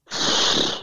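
long weary breath out; a tired, breathy sigh of frustration; exasperated sigh. Real, not acted. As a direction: sigh like it's not worth explaining. Exasperated at a clumsy comparison.

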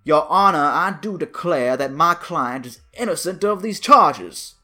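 put-on southern lawyer accent. Imagined Southern lawyer addressing the judge, in a loud, hammed-up Southern accent. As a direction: boom it out like an old Southern lawyer. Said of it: "he'd say".

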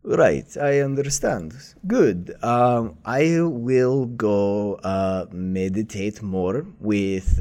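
slow, lightly voiced old master; unhurried kindly old mentor; Master Oogway voice. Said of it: "he says".

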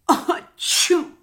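breathy voiceless sneeze; a sharp unvoiced achoo. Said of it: "Mickey went again".

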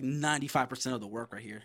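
ordinary talk

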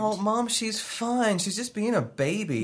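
put-on high, whiny kid voice; young boy. Boy [name] answering his mother, whiny and high. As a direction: play the boy brushing it off. Adult plays his child self.

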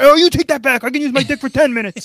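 high, loud offended outburst; indignant Jack. Pretend. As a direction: blurt it out, hurt and indignant. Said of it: "If he was like".

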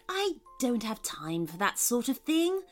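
usual speaking voice

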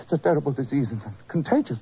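ordinary talk, nothing marked

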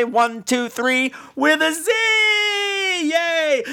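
high, drawn-out count-off; sing-out countdown ending in a high yay; rallying cheer. Pretend. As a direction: lead the crowd to a big high cheer. Leads mock group count and cheer.

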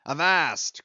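loud stern halt; commanding cry. A narrator's reading. Ahab halting the crew with a loud cry. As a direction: stop them dead with one word. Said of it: "cried Ahab".

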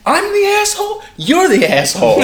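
very high, loud, incredulous comeback; indignant mock yell. Storyteller imitating cyclist yelling back at lady, loud indignation. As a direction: shout it back in hammed-up outrage.

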